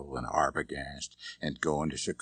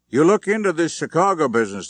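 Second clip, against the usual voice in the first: higher, louder call across the room; calling after them; 10 semitones higher, 11 dB louder, faster than usual. Acted. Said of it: "the big man called after them".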